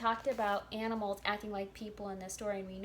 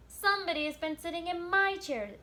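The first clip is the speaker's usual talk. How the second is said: higher, louder little bear; lightly voiced tiny bear, raised; little wee bear voice; 7 semitones higher, 4 dB louder than usual. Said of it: "in his little small wee voice".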